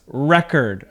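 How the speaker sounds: record as REK-erd, weight up front; record with a short, weak second syllable; noun stress on first syllable. Shown as a demonstration. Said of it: "the word sounds like this".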